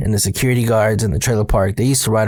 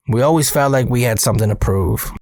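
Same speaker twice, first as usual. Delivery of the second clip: plain reading voice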